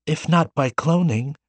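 a hint of sobbing through the words; crying. A narrator's reading. Girl crying over humanity's end, mild tears. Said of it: "the girl was now crying uncontrollably".

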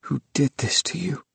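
high, whispery murmur. A narrator's reading. Willis asks his dead friend who hurt him, murmuring. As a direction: ask it in a hushed, grieving whisper. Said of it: "he murmured at last".

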